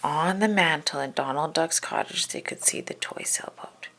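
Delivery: soft unvoiced narration; whispered narration. A narrator's reading. Narrating the sneak into Donald's cottage in a whisper. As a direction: hush so as not to wake him.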